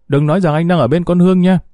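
pointed suspicious jab, quick; jealous accusation. A narrator's reading.

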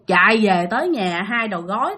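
usual speaking voice

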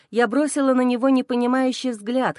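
plain reading voice

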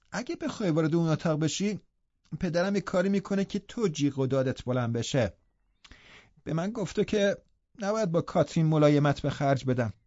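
put-on a spoiled invalid boy refusing; sullen sickly boy. A narrator's reading. Linton threatens with his father.

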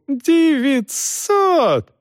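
high-pitched captain's tirade; outraged yelling. A narrator's reading. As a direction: demand answers in a high, strained cry. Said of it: "заорал Пруф".

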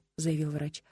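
ordinary delivery

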